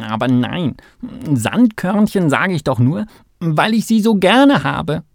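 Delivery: hoch und laut beteuernd; rufend. A narrator's reading. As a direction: aus der Entfernung eifrig zurückrufen. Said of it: "rief Kalle". (lauter, begeisterter Maulwurf)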